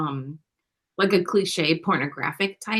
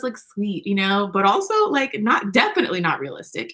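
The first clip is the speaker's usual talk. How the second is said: ordinary talk, nothing marked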